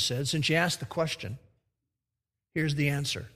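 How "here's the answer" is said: ordinary talk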